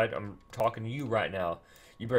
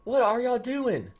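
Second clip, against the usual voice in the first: exasperated disbelief; 10 semitones higher than usual. Real, not acted. Gamer to his dying heroes, exasperated. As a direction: demand an answer in high-pitched dismay.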